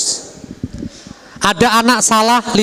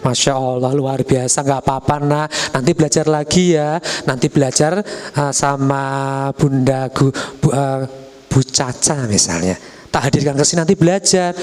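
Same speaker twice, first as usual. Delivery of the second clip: tender mother; 5 semitones lower than usual. Acted. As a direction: comfort the child kindly. Kind mother responding. (ibu memuji dan menenangkan dengan lembut)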